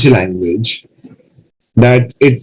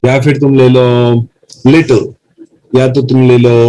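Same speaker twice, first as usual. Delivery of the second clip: ordinary talk, nothing marked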